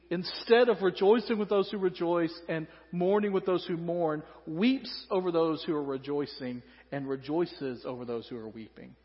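ordinary delivery

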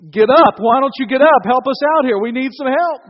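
loud, exasperated call to get up; urgent yelling. Acted. Storm-tossed sailor to sleeping Jesus, urgent yelling. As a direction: rouse a sleeper, loud and pressing.

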